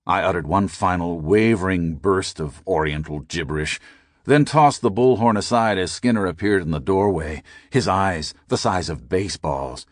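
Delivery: usual speaking voice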